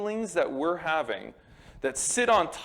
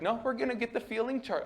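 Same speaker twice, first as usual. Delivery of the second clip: high, quick, soft mock counselor; put-on high-pitched therapist, hurried; hammed-up counselor; 8 semitones higher, 5 dB quieter, faster than usual. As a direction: imitate a counselor in an exaggerated high voice. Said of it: "I don't think he talked like that".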